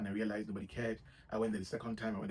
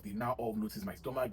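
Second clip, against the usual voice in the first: ordinary delivery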